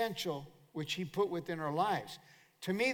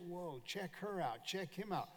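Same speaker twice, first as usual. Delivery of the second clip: much softer, as a sly aside; hushed mock remark; 10 dB quieter than usual. Mimicking people ogling or judging others.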